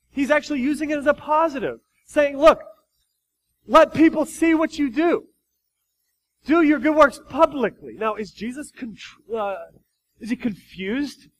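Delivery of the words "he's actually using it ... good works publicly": loud, high emphatic preaching; forceful preaching. Acted. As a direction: build to full force.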